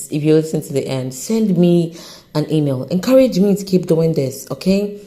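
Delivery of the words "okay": ordinary talk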